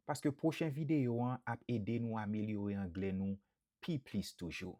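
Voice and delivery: ordinary talk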